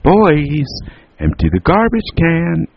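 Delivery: put-on loud, high "Boys", then a high held order; calling out. A narrator's reading. Mother calls just as boys sit down, calling out. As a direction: holler it musically up to them. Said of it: "their mother Alice called".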